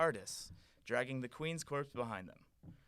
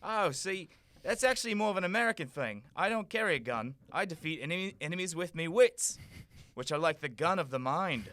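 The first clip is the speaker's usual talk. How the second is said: very loud, fast cockney; cockney Doctor; 5 semitones higher, 6 dB louder than usual. Acted. The Doctor offering to help the prince, in loud cockney. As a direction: boast in brash cockney.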